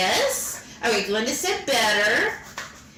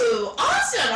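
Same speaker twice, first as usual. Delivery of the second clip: excited cheer; 8 semitones higher than usual. Real, not acted. Cheering at viewer's news.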